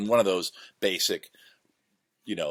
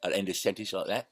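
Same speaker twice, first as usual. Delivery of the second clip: fast, dopey fool voice; village idiot voice; 2 semitones higher, faster than usual. Shown as a demonstration. Demonstrating his Ren Fair idiot character, quick dopey voice. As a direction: rattle it off like the village simpleton. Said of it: "Did a lot of sounds like that".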